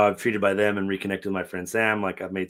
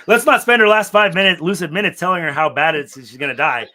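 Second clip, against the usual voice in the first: loud, high, insistent urging; emphatic high-pitched appeal; urgent plea; 8 semitones higher, 12 dB louder than usual. Retold or imitated. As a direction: raise the voice and press the point. Urgent plea in retold bedside scene.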